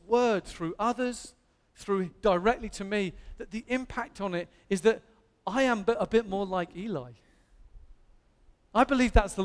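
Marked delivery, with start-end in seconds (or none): none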